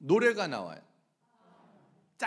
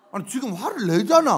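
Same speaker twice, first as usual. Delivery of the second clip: loud insistent comeback; accusing retort; 9 dB louder than usual. Acted. Relative pointing out the other's anger, loudly accusing. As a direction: argue back forcefully. Acted family quarrel.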